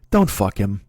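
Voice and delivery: low-pitched, curt retold remark; frustrated jab. Boyfriend at football practice to girlfriend, frustrated and low. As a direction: drop the pitch for a frustrated command. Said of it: "I was so frustrated".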